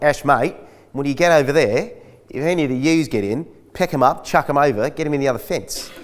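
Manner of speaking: casual instructions in a hint of a farmer's voice; farm boss giving orders. Farmer telling the new hand how to handle ewes, lightly voiced. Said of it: "were like".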